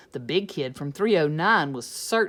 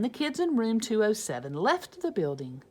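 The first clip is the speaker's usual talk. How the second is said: plain reading voice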